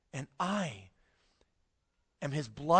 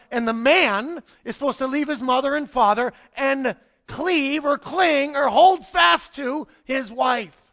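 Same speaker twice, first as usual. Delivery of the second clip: loud, slowing preacher's build; preaching at full force; 4 semitones higher, 7 dB louder than usual. Acted. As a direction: preach it out, stretching the words. Preaching the marriage passage.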